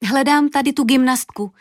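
put-on high, ringing child's voice; ringing, hearty call. A narrator's reading. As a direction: call it out brightly, pitched high. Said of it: "zahlaholila Julinka, až se to rozlehlo".

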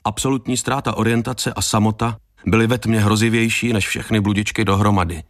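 ordinary delivery

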